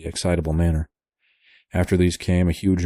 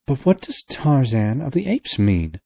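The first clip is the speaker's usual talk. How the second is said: put-on higher young woman's voice; girl crying out; 7 semitones higher than usual. A narrator's reading. Girl crying out about the sign, lightly voiced. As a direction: ask it, puzzled and urgent. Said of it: "cried the girl".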